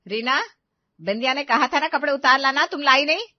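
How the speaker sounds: loud, high reproach; cross mother-in-law's telling-off; scolding. Acted. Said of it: "शांति ने उसे डांटा है".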